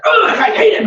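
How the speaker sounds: high mock child outburst; child's angry cry. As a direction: imitate a furious kid, laughing. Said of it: "He's like".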